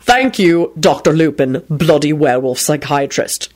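ordinary delivery, nothing marked

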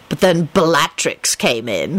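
ordinary delivery, nothing marked

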